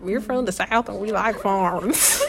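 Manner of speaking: slow, drawn-out Southern twang; Southern accent. Pretend. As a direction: stretch the vowels in mock Southern. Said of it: "we chose Southern accents".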